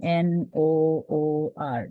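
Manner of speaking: slow spelling. Shown as a demonstration. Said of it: "एक नाम है नूर".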